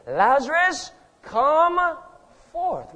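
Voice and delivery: high, loud summons with a pause before the last word; commanding call. Acted. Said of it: "calls into the place of death".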